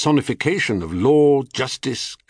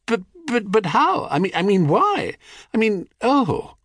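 high, stammering and flustered; flustered disbelief; 12 semitones higher than usual. A narrator's reading. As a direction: stumble over the words, lost. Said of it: "stared at his mother in disbelief".

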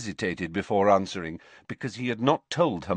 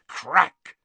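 loud spoken crack, higher; sharp crack sound; 8 semitones higher, 4 dB louder than usual. Acted. Second elf appears.

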